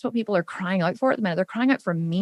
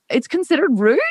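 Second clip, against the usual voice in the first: high, louder disbelieving echo; incredulous question; 6 semitones higher, 4 dB louder than usual. Retold or imitated. Told about "you're welcome", high incredulous echo. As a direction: repeat it in disbelief. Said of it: "And I was like".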